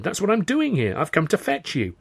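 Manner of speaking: high-pitched eager declaration; excited cry. A narrator's reading. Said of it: "I cried".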